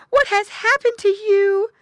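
high, loud worried question; mother pig. A narrator's reading. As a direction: cry out to your hurt child. Mother pig exclaims over her child.

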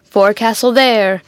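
a firm, loud hail. A narrator's reading. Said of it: "Spike was hailing the forecastle".

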